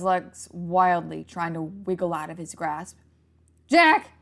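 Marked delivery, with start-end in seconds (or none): 3.7-4.1 high piercing shriek of a name. A narrator's reading. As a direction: shriek his name in terror. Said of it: "Molly shrieked".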